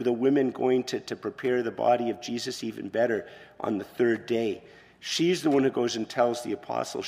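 normal talking voice

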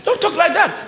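high-pitched exasperated reproach; mock scolding. Scolding the complainers.

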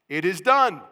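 loud, forceful declaration; exclamation point. Shown as a demonstration. Preacher repeating the heavenly cry for the church, loud. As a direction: slam DONE down hard. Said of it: "Exclamation point number two".

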